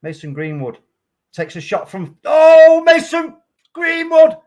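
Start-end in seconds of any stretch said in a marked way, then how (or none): 2.2-4.4 huge high roar of joy at the goal; goal celebration yell. Real, not acted. Fan watching his team's striker score, a real ecstatic shout. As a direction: scream it like your team just scored.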